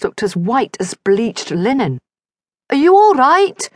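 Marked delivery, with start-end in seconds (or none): 2.7-3.7 loud, high holler over the wind. A narrator's reading. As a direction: call back hard to the wounded rider. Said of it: "she hollered".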